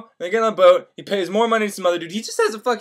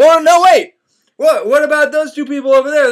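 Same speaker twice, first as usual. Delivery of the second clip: leaping up high and loud; sudden excitement; 8 semitones higher, 8 dB louder than usual. Retold or imitated. Candide points out two people to Martin, suddenly excited. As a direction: burst out as if just spotting the answer. Character suddenly spots happy couple.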